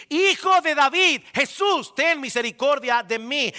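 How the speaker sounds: high, loud cry on Hijo de David; shouting. Acted. Bartimeo shouting to Jesus over the crowd, full cry. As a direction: shout the title out to be heard. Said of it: "a gritar y a decir".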